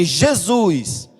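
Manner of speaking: loud call of Jesus, retelling her cry; retold shout. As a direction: cry out the name as she did in the story. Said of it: "E ela gritou assim". (grito contado, bem mais alto)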